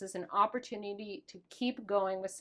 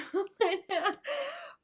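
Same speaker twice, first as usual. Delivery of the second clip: laughing cry; 11 semitones higher than usual. Real, not acted. Moved by talk of daughter.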